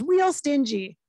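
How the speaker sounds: mock New Jersey accent; Ray Liotta. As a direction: punch it like a New Jersey guy. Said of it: "He has a bit of an accent".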